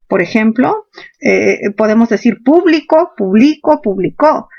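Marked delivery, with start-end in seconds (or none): 2.4-3.1 público stressed on PÚ, the first syllable. Shown as a demonstration. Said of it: "Hay palabras esdrújulas". 3.2-3.8 publico stressed on BLI, the middle syllable. Shown as a demonstration. 3.8-4.5 publicó stressed on CÓ, the final syllable; stress on last syllable. Shown as a demonstration.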